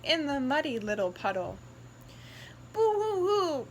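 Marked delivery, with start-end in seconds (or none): 2.7-3.7 higher, mock boo-hoo wail; mock crying. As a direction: pretend to cry. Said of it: "cried Piggy's brother".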